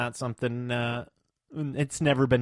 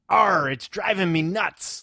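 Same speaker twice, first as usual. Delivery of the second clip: pirate voice; 5 semitones higher, slower than usual. Retold or imitated. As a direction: ham up the pirate Arr. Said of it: "And the pirate says".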